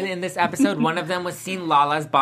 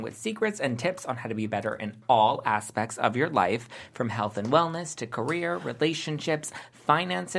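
ad read; 5 semitones lower, 3 dB quieter than usual. Acted. Hosts read a podcast ad.

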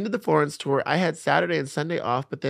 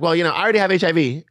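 loud, higher, quick blurt; blurted retort; 5 semitones higher, 7 dB louder, faster than usual. Retold or imitated. Retelling own reply to partner's looming confession, loud quick blurt.

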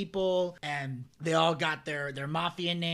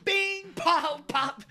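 much higher mock punch noises; mock punch sounds; 10 semitones higher, 3 dB louder, much slower than usual. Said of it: "He's like".

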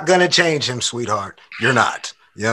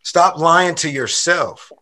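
normal talking voice

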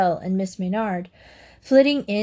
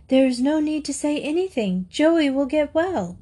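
higher, lightly voiced schoolgirl; a hint of a kindly schoolgirl, pitched up; Bernhilda; 6 semitones higher than usual. A narrator's reading. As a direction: reassure the headmistress in a raised pupil's voice.

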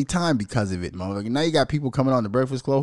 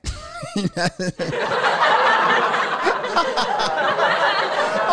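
high shrieking laugh; 5 dB louder than usual. Real, not acted. Falsetto laugh in impression.

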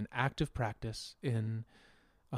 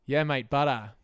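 louder mock Aussie accent; Australian accent; 3 semitones higher, 7 dB louder, slower than usual. Shown as a demonstration. Demonstrating Australian speech to friends, mock Australian accent. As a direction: play an Aussie mate, T softened to D. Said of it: "the Australians do more".

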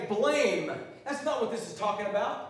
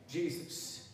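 hushed; 3 semitones lower, 11 dB quieter than usual. Acted. Dramatic hushed name.

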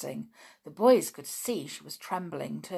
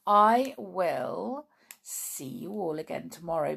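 plain reading voice

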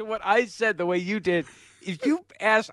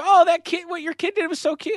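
high-pitched mock gushing over a child; imitated high fawning compliment; gushing onlooker; 10 semitones higher than usual. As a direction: coo like an admiring acquaintance, pitched high. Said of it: "people go".

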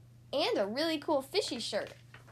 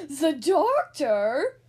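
loud, slow, shocked question; wide-eyed surprise; 10 dB louder, much slower than usual. Acted. Spike hearing Splat must see the doctor, loud shocked disbelief. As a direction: gasp it out in disbelief. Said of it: "Spike's eyes went wide".

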